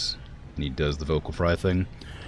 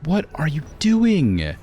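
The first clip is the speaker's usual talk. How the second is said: incredulous yell; 12 semitones higher, 5 dB louder than usual. Real, not acted. Yelling at malfunctioning game AI.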